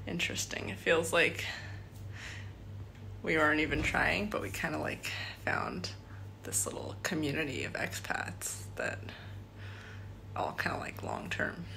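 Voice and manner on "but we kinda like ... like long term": whispered, unvoiced aside; softly whispered to camera; hushed. Real, not acted. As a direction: keep it under your breath so nobody hears. Quiet filming among expats.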